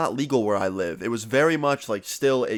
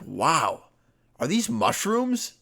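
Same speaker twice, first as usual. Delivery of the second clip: imitating a cop's drawn-out, high-pitched surprise; slow mock amazement, rising well above your usual pitch; astonished cop; 8 semitones higher, much slower than usual. Cop to teens on finding their mushrooms, acted astonishment.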